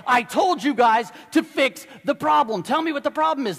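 loud, high barked order over the PA; angry captain shout. Retold or imitated. Captain shouting down at the crew over the PA, furious. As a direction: bark it at the crew, fed up.

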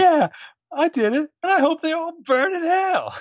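high, loud hammed-up defiant confession; Samuel L. Jackson impression. Imitating Samuel L. Jackson's courtroom confession, high and loud. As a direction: shout it out like a defiant defendant. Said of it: "Samuel L. in Time to Kill".

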